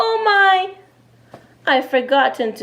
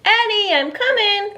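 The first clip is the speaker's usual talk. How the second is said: calling out; 10 semitones higher than usual. Acted. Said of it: "called Mom".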